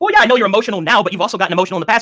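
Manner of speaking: real argumentative outburst, pitch up. Real, not acted. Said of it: "I, I raised my voice".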